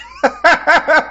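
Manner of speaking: a sudden high burst of laughter. Real, not acted. Streamer cracking up at the stranger, high laugh. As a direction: cackle high and loud.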